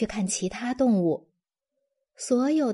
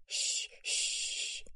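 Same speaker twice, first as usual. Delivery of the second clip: hushing; 11 dB quieter than usual. A narrator's reading. Tiger hushing, twins asleep.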